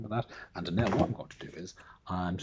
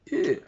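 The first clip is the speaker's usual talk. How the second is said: disgusted ew; 8 dB louder than usual. Acted. Disgust at slimy frog.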